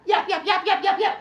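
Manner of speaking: high, loud mocking yaps; yapping chatterbox. Teacher mocking a nonstop talker, high loud yapping. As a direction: parody a kid who never stops talking. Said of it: "talk like a water fountain".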